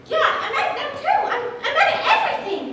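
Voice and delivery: giggling through a mock grumble; laughing. A girl laughing while saying she's mad at everything.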